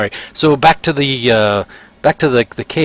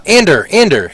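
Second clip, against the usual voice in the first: raised call across the shop; higher calling voice; 7 semitones higher, 4 dB louder than usual. Acted. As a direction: call the boy over. Shopkeeper calls his clerk.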